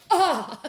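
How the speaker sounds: loud, high yelp; mock pained yelp. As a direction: imitate a dog's sudden cry of pain. Imitates dog's pained yelp.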